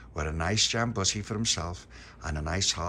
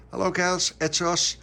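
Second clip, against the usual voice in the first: high greeting; 10 semitones higher, 7 dB louder than usual. A narrator's reading. Puppy greets girls.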